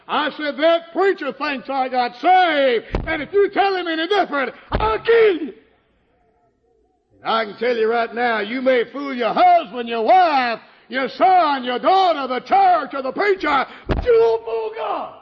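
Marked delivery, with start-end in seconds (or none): 0.6-5.5 high, loud threat building to a yell; husband threatening his wife, louder and louder; angry threat. Retold or imitated. Threatening wife in retold quarrel. 7.2-15.2 rising into a preaching shout; preacher warning the congregation, ending in a shout; building sermon. Acted.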